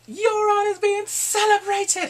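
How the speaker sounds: hammed-up triumphant call; mock fanfare. Real, not acted. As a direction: announce it like a parade herald. Mocking Euron's triumphant parade.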